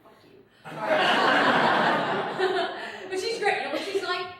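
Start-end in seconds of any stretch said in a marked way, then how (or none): 0.0-0.4 soft muttered fuck you; under the breath, dismissive; barely voiced retort; muttered comeback. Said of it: "I'm like".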